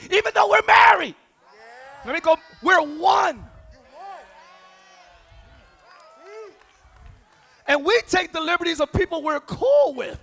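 loud, high shouted preaching; shouting sermon climax; full-force preaching. Acted. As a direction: drive the point home to the church.

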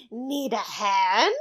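slow, lightly voiced creepy leprechaun through a laugh; a hint of a sly little leprechaun, drawn out. Friend imitates the movie leprechaun offering a hand, lightly voiced creepy leprechaun. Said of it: "the leprechaun's voice is like".

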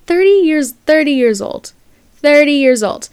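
high, loud stress on thirty; incredulous outburst. Real, not acted. Outraged at the grown man's texts, loud disbelief. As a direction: shout his age in disbelief.

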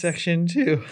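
cracking voice, nervous. Real, not acted. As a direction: voice breaks on the words. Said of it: "Was that a voice crack?".

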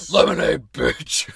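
loud, commanding mock-teen shout; cracked puberty voice. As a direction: bellow it like a suddenly bossy lemonade seller. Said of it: "it always dropped so low".

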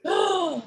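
high, quick gasp; reacting gasp. Real, not acted. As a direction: gasp at the score. Reacts to co-host's rating.

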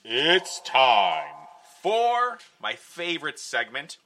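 loud, slow dramatic announcer. Acted. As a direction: boom it out like a game show host. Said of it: "That didn't sound like a very sinister laugh".

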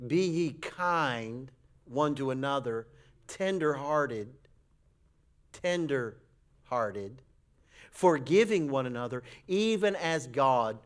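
slow, weighty Bible reading; solemn scripture reading. Acted. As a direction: linger on every word of the verse.

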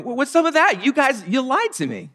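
high, indignant complaint; a wronged king protesting at being lied to; frustrated. Retold or imitated. King Abimelech to Abraham, high frustrated protest. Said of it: "Abimelech is rightly frustrated".

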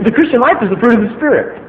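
normal talking voice

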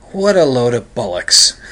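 lightly imitated British rocker, dismissive; a hint of rough rock-star British. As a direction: drop the act into a blunt British put-down. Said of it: "He turns back into [name]".

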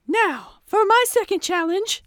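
high, grand announcement of the challenge; theatrical villain host. Acted. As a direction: present the next trap like a showman.

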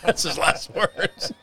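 laughing through the words; words broken by laughter. Real, not acted. Laughing after the joke.

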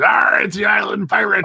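hammed-up pirate call. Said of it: "he had a pirate voice".

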